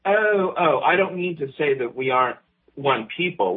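normal talking voice

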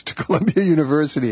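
real chuckle breaking into the words; laughing. Real, not acted. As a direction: laugh through it in disbelief. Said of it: "excuse me for laughing".

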